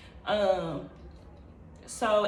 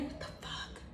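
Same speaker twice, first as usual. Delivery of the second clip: breathless whispered disbelief; shocked whisper; 18 dB quieter than usual. Retold or imitated. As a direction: whisper it to yourself, freaked out. Stunned while driving, seeing animations.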